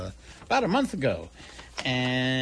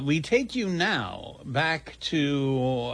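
ordinary delivery

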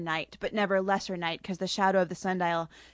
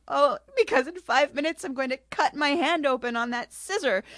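half-hearted weepy lament; mock crying queen; 6 semitones higher than usual. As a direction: play her weepy worry lightly. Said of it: "she starts crying".

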